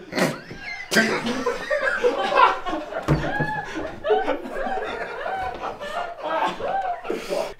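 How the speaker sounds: high wheezing laugh that won't stop; long laughing fit. Real, not acted. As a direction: lose it completely, laughing high.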